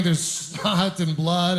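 ordinary talk

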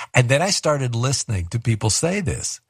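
ordinary talk, nothing marked

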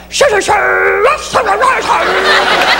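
hammed-up child yelping; silly kid noise. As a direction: play a goofy toddler. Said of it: "just suddenly go".

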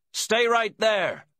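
high, loud, drawn-out order; slow forceful call, pitched right up; commanding. A narrator's reading. Voice from the caravan orders the visitor to stay put, commanding. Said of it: "came the commanding voice from inside".

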